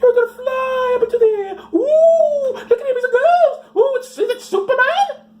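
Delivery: shrieking high mock excitement; mock rapture glee. Comic mocking believers flying up, shrieking high. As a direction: squeal like a believer floating off.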